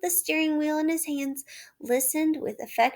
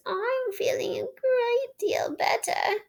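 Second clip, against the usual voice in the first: feeble voice; 12 semitones higher, slower than usual. A narrator's reading. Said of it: "said Toad in a feeble voice".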